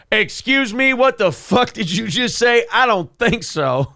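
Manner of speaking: high-pitched, affronted overhearer; mock indignant outburst. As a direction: act out offended disbelief, pitch jumping up.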